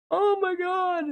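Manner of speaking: oh my God jumps way up in pitch; a high, strained groan of disbelief; high-pitched exasperation; exasperated squeal. Real, not acted. Exasperated exclamation.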